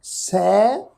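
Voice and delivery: ਸੈ with the open ai vowel, not ਸੇ; careful vowel. Shown as a demonstration. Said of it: "ਇਹਨੂੰ ਸੇ ਨਹੀਂ ਪੜ੍ਹਨਾ".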